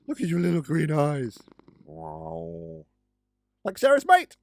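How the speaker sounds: high cooing pet voice; baby talk. Pretend. Praising the cat's green eyes in baby talk. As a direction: gush over the cat like a baby.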